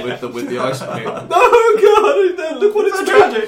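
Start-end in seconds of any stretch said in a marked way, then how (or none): 1.1-3.5 high, loud, laughing anguish; hammed-up despair. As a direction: wail it in mock tragedy. Mocking an anguished Lara Croft.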